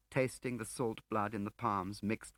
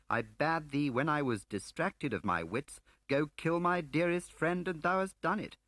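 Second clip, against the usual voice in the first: theatrical reciting of a line from a play, higher and louder; mock recitation; 7 semitones higher, 5 dB louder than usual. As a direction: ham up the old play verse. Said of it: "reciting Webster".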